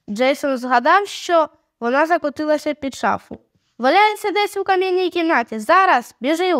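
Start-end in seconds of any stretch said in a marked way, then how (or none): 3.8-6.5 high-pitched, insistent retort; urging. A narrator's reading. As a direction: send someone running, pitched up. Urging sibling to fetch the pen. (нетерпляче підганяння)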